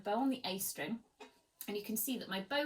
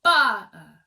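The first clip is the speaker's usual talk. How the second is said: loud first hit, second one dropped; lazy second note; 2 semitones higher, 20 dB louder than usual. Shown as a demonstration. Teacher showing the lazy second accent. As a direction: punch the ba, let the second die. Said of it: "what can sometimes happen, it goes".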